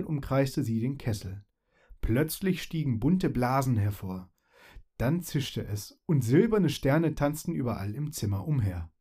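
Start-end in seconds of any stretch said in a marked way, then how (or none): none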